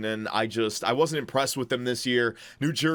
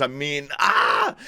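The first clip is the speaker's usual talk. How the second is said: frustrated ah; slower than usual. Real, not acted. Torn over a risky pick.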